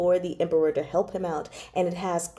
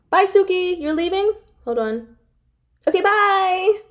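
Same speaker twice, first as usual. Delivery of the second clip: higher, brighter farewell with a quick hold-on; cheery goodbye; 11 semitones higher than usual. Real, not acted. Reader breaking off to say bye to Suki as she leaves. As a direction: sing out a goodbye to a friend. Says goodbye to someone leaving.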